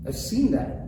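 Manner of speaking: ordinary talk, nothing marked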